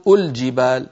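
الجبال begun with "u", "ul-jibaal"; wrong opening damma. Shown as a demonstration. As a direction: say الجبال as "ul-jibaal", first vowel u. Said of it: "ولا نقول".

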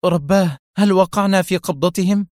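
high-pitched, startled question; alarmed exclamation. A narrator's reading. Mona learns where they are held, alarmed. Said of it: "وهي تهتف".

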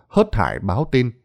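usual speaking voice

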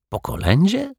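very high rising question; wondering repetition. A narrator's reading. Detective puzzled by a carol visit, wondering. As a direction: query it, pitch soaring at the end. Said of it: "powtórzył z zastanowieniem detektyw".

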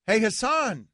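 ordinary talk

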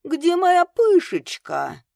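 high, loud, drawn-out call; loud proclamation. A narrator's reading. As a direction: boom it out slowly like a drunk gnome. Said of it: "провозгласил Морфий".

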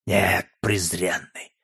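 slow, low, menacing refusal; enraged contempt. A narrator's reading. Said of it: "выходя из себя от бешенства". (медленно и низко, с презрительной яростью)

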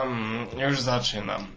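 ordinary talk

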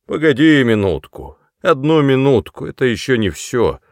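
put-on loud, knowing drunken tease; meaningful exclaim. A narrator's reading. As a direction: promise a surprise, significantly. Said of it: "воскликнув многозначительно". (многозначительно, громко)

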